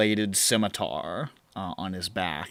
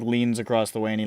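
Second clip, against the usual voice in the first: ordinary delivery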